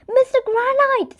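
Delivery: high joyful greeting. A narrator's reading. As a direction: greet a long-lost twin. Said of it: "said the Alan".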